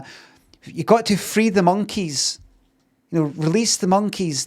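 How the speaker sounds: high-pitched pleading in a retold quote; mock-earnest high plea. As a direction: act out an urgent plea, pitched well up. Acting out the witch's earnest plea.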